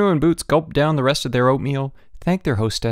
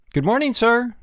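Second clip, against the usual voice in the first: high-pitched schoolboy greeting; chorused greeting; 13 semitones higher than usual. A narrator's reading. Schoolboys jump up to greet headmaster, chorused. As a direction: chime it out like a class in chorus. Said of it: "they chorused".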